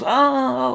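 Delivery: high, drawn-out mock cry of pain; imitated pained cry. Little kid held by a bully, mock pained wail. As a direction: imitate a kid yelping as his arm is twisted. Little kid's arm twisted behind back.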